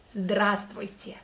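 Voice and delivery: Здравствуйте said slowly and carefully, each sound drawn out; teacher models Здравствуйте slowly; slow demo of Здравствуйте. Shown as a demonstration. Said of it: "this long word, long greeting".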